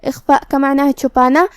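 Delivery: ordinary talk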